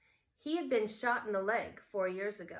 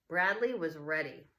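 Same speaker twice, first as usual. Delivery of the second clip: plain reading voice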